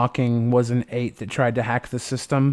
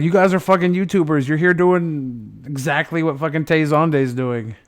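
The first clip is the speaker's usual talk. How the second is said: loud, high exasperated scolding; exasperated rant; 4 semitones higher, 5 dB louder than usual. Retold or imitated. Streamer retelling scolding fanboying YouTubers, exasperated. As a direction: tell them off, fed up. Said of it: "I was like".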